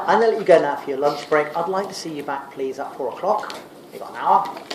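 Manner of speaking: normal talking voice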